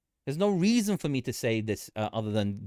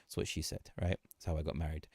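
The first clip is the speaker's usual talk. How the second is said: whispered joke; 9 semitones lower, 11 dB quieter, faster than usual. Real, not acted. Joke aside.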